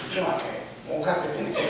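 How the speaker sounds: hushed, confiding aside; hushed secret. Retold or imitated. Boss quietly confides the profit, hushed and amused. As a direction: lean in and let the secret slip quietly.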